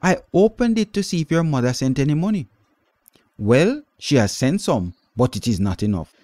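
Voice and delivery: high, loud, fast scolding aunt; stern aunt. A narrator's reading. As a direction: lecture the girl impatiently.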